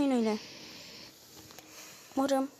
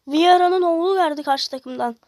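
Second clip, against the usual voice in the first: heyecanlı yüksek ses; 9 dB louder than usual. Real, not acted. Rakip oyuncuya şaşkın çıkış.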